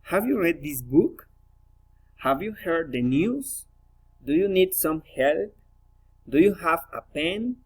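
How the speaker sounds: yes-no questions swept upward on "book", "news", "help", "pen"; rising question intonation. Shown as a demonstration. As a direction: lift the last word of "Have you read this book?" and each question. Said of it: "rising intonation".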